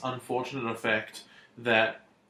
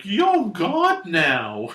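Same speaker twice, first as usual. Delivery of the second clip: mock worship; 5 semitones higher, 6 dB louder, much slower than usual. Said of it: "to just being like".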